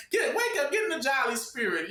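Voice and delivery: high-pitched, pushy mock enthusiasm; hammed-up festive urging; mock holiday cheer. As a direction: rouse them, imitating an overeager celebrant. Acting out pushy holiday celebrants.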